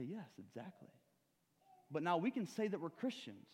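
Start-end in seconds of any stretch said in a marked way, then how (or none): 0.0-0.9 quiet agreement. Retold or imitated. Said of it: "And I say".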